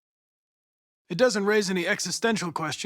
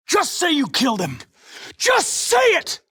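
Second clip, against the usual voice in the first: furious shout; 10 semitones higher, 4 dB louder than usual. Acted. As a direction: force the confession out of him. Interrogating, losing patience.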